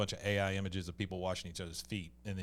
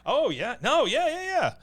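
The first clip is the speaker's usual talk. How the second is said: excited; 13 semitones higher, 7 dB louder, slower than usual. Real, not acted. Excited agreement.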